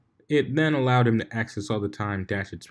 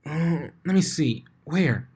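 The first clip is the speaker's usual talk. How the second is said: slow, much higher, curious; higher Pinkie voice; 7 semitones higher, slower than usual. Acted. Pinkie looking for Blitz's lump, high and curious. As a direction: peer around for the lump.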